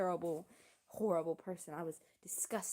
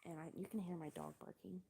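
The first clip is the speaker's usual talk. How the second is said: ordinary talk, nothing marked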